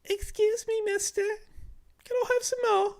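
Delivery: put-on high-pitched little British boy voice; soft, high child's plea in a British accent. Shown as a demonstration. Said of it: "I turned mine into a British boy".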